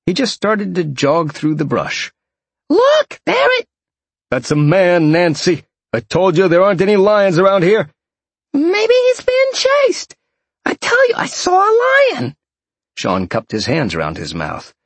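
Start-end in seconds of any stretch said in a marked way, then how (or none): 2.7-3.7 very high, loud falsetto cry; alarmed woman. A narrator's reading. Nancy pointing out the lion, high alarmed cry. 4.3-7.9 higher, louder, fed-up man; exasperated. A narrator's reading. Jeff telling Nancy it's a man, loud and exasperated. 8.5-12.3 very high falsetto woman; insistent woman. A narrator's reading. Nancy still insisting on a lion, high and insistent.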